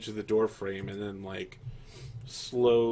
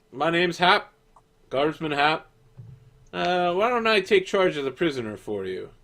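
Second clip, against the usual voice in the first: guard voice; 7 semitones higher, 5 dB louder than usual. Acted. New guard introduces himself.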